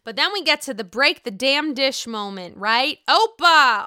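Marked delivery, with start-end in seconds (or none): 3.1-3.8 loud, high "Opa!" cheer; Opa shout. Retold or imitated. Host reenacting the Greek dish-breaking cheer, loud.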